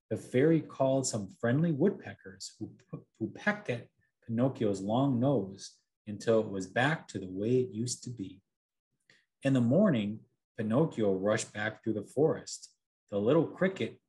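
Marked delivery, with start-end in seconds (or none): none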